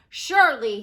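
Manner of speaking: smug boast. Acted. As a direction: puff up with royal pride. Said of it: "he boasted".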